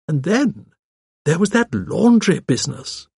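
put-on genteel old lady, reminiscing; old lady sleuth.

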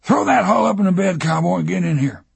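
booming high rough truck driver; whisky voice. A narrator's reading. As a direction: boom it out like a whiskey-soaked driver. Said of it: "boomed at him in a whisky voice".

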